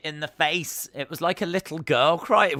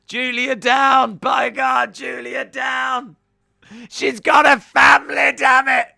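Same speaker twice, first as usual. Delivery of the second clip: much higher and louder, slow hammed-up yelling of "Julia down"; mock commentator outrage; 12 semitones higher, 12 dB louder than usual. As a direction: ham it up like a stunned ringside announcer. Mock commentator calling the fall.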